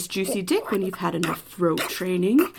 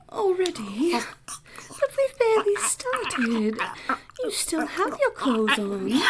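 whiny; 5 semitones higher, 3 dB quieter than usual. Acted. Twin sister pleading for turn.

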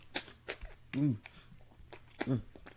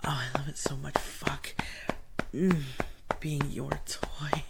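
whispering; 3 semitones higher than usual. Acted. Whispered roleplay.

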